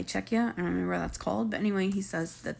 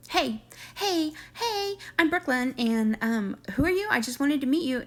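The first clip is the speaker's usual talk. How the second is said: much higher, pushy mock greeting; mock Brooklyn; 5 semitones higher than usual. Vlogger imitating Brooklyn banging on the truck window, high mock greeting. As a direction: ham up the nosy girlfriend at the window.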